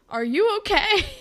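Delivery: high, loud disbelief; alarmed. Retold or imitated. Claire staring at her friend, high alarmed disbelief. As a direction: ask it in shock.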